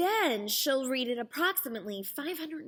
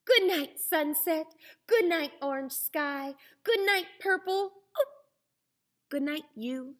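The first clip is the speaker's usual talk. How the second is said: chirpy bird goodnights; 5 semitones higher than usual. A narrator's reading. Bird saying good night.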